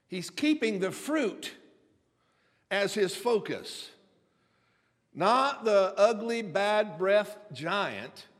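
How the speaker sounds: loud, slow, high preacher's build; fiery preaching. Acted. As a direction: preach it with rising fervor. Preaching at full force.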